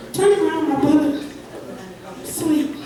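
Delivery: ordinary talk, nothing marked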